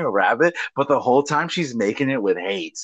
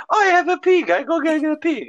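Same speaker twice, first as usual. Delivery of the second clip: mock Portuguese lady, much higher and chirpy; Portuguese lady's offer. Friend mimics a Portuguese lady offering a pig, high and chirpy. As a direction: squeak it out like a cheerful old lady.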